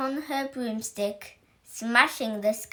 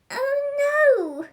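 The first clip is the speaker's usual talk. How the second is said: very high, loud squeaky cry; squeaky panic; 7 dB louder than usual. Acted. Scared little werewolf squeaking in panic, very high. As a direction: squeak out the dread. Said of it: "werewolf with the squeakiest voice".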